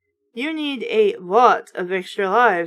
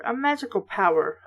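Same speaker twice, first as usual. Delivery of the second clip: ordinary delivery, nothing marked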